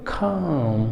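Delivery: खाम with a breathy aspirated kh; English-style खाम, aspirating the k softly; soft British-style खाम. Shown as a demonstration. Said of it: "उन्हीं की तरह बोलेंगे".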